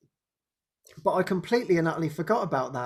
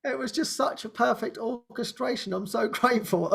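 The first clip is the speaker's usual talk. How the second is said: much higher, gushing thankful voice; grateful translator; 9 semitones higher than usual. Retold or imitated. Translator Anya thanking the speaker, high and gushing. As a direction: gush your thanks, nearly tearful. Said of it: "And she just said".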